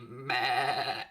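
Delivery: bleating goat sound; goat impression. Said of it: "That's my goat impression".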